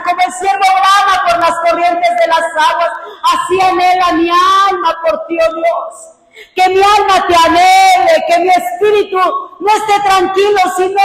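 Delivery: loud, high impassioned calling out to God; fervent prayer. Real, not acted. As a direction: cry out to God with full force. (oración ferviente a gran voz)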